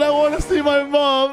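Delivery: high mock-crying wail; tearful child. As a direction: whimper like a kid wanting mom. Voicing child crying for mother.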